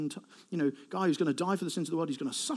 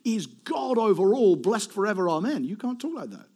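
higher, emphatic protest; acted objection; 5 semitones higher than usual. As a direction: object firmly, starting high and forceful.

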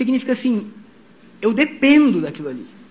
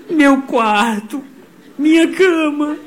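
put-on higher, tearful little boy voice, slowed at first; imitating crying son; 6 semitones higher, slower than usual. Pastor mimics his crying son missing the old house. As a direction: mimic the sobbing son remembering his room. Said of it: "Lucas começa a chorar".

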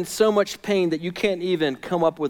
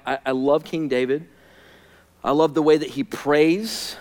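ordinary delivery

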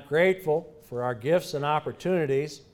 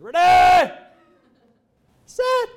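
voice cracking up an octave; 13 dB louder, slower than usual. Retold or imitated. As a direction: voice breaks into a high squeak. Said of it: "and my voice cracked".

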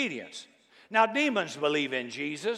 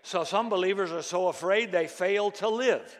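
ordinary talk, nothing marked

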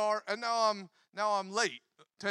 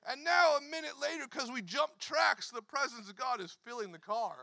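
normal talking voice

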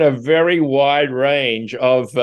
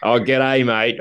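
easy Australian g'day mate, no push needed; relaxed Australian accent, understated; slower than usual. Shown as a demonstration. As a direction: toss off an Aussie g'day mate like a native. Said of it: "Give me your best Australian accent".

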